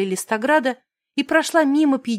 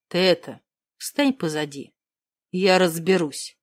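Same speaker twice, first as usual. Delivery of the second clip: низкий мужской голос; 7 semitones lower than usual. Acted. Ревнивец отстраняет героиню.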